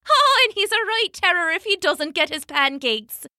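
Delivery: light, high motherly voice; a mother covering for her baby; Una's sweet voice. Acted.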